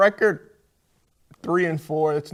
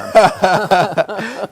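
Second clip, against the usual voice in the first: short loud laugh; chuckling at the joke; amused laugh; 7 semitones higher, 11 dB louder than usual. Real, not acted. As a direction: laugh at the quip. Host laughs at reply.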